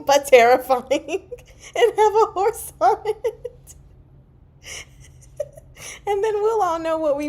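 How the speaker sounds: high, laughing over the words; cracking up at her own joke. Real, not acted. Pitching a silly shirt slogan, laughing.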